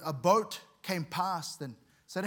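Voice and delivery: ordinary talk, nothing marked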